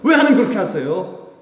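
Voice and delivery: high, indignant questioning of God; mock indignant complaint. Believer challenging God over hardship, high indignant complaint. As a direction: demand an answer from God, half-mocking.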